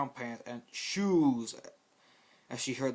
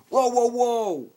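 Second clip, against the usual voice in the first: very high, very loud, slow whoa; startled exclamation; 11 semitones higher, 14 dB louder, much slower than usual. Acted. Scout startled by the talking pony, exclaiming loudly. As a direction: leap back in shock. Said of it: "Scout exclaimed".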